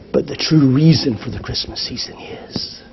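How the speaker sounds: soft, reverent whisper of the name; hushed whisper. Acted. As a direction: whisper it like a secret revealed. Hushed reveal of the Christmas meaning.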